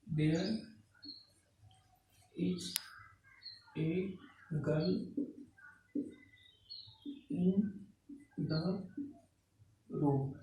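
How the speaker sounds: soft single words with long pauses; word-by-word dictation. Real, not acted. Teacher writing a new example for the class, halting word-by-word dictation. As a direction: say each word as you slowly write it.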